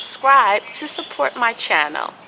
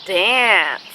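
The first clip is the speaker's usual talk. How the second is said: slow, gentle storybook reading; picture-book read-aloud with long pauses; reading to children; slower than usual. Acted.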